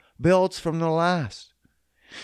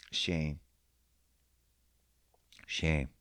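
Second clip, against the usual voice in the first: mock somber; 11 semitones lower, 10 dB quieter than usual. Real, not acted. Mock mourning the buddy's diabetes.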